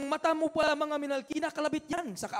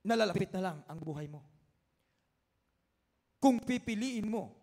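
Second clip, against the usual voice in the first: ordinary talk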